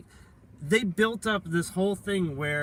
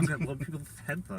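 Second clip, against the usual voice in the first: lisping villain; 4 semitones lower, 6 dB quieter, much faster than usual. Retold or imitated. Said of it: "with a lisp".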